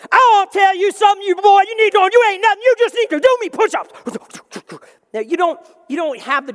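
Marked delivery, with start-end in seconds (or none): 0.1-3.9 much higher, much louder barked orders; drill sergeant. Retold or imitated. Drill sergeant screaming at a recruit, much higher and louder. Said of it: "drill sergeant in your face".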